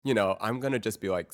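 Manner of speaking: ordinary talk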